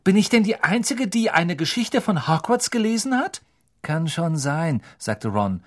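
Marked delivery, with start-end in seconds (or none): 0.1-3.4 higher exasperated know-it-all; indignant. Acted. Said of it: "sagte Hermine entrüstet". 3.8-4.8 slow, drawn-out shrug; unbothered. Acted. Ron shrugs off Hermine.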